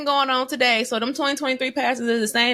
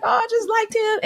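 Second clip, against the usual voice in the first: gushing crush; 12 semitones higher than usual. Retold or imitated. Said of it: "I was just like".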